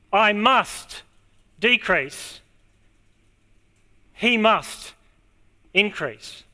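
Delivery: MUST held, then a pause before "decrease" and "increase"; stress on MUST. Shown as a demonstration. Preacher quotes John on himself and Jesus, stressing "must". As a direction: lean on each "must", letting the next word fall. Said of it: "I'll put the emphasis on the right word here".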